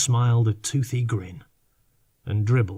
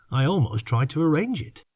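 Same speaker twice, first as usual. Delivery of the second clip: ordinary delivery, nothing marked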